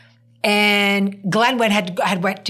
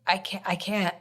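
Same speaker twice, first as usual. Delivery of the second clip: faint, much softer, near tears; choked up; 9 dB quieter than usual. Retold or imitated. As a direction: barely get the words out, fighting tears. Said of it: "And I'm like".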